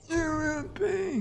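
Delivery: very high, slow mock threat; put-on accented game voice. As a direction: draw out a high-pitched, hammy threat. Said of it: "What kind of accent does that?".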